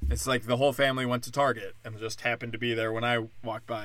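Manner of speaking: normal talking voice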